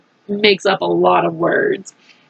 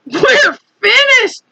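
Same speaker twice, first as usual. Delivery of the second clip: panic; 10 semitones higher, slower than usual. Acted. Fire chief panicking.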